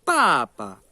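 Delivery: "Papa" with a loud falling first syllable and a light second; fourth tone, then neutral. Shown as a demonstration. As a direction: punch the first "pa" downward, let the second drop away. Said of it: "ou em papai".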